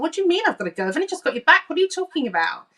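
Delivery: high, rushed panicky protest; reliving the panic of being sent back. Retold or imitated. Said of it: "getting a bit panicky".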